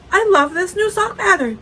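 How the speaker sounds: high, loud gushing delight; mock gushing. Friend retelling Sarah's phone call, high gushing delight. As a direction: gush it loud and high, as retold. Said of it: "and she said".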